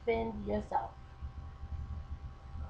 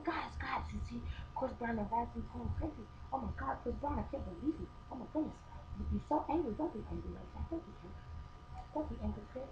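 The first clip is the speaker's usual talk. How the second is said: whispered, half-laughing asides to the guys; hushed bus whisper; 11 dB quieter, faster than usual. Retold or imitated. Reading tweets on the bus to friends, whispered and teasing.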